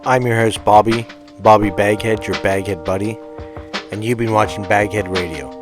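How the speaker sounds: usual speaking voice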